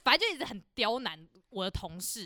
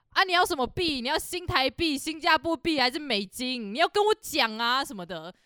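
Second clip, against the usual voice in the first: loud, higher, mocking taunt of a rude customer; 5 semitones higher, 5 dB louder than usual. A customer taunts the coworker at the register, loud and mocking. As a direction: sneer it like a customer picking a fight. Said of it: "那客人直接这样对我同事讲".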